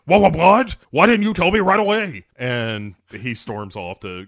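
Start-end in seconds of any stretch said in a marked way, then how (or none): 0.1-2.3 high, loud and rattled, words tumbling out; a chief caught off guard, yelling; flustered police chief. Retold or imitated. Police chief learns the lawyer is on the phone, loud startled outburst. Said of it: "And he's like".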